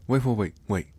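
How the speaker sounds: loud, hurried calls to hold still; urgent call to stop. Acted. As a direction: rush to stop them getting up. Stopping the startled listener from moving.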